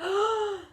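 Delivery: shocked gasp. A narrator's reading. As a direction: gasp as the doors burst. Kids stare in shock.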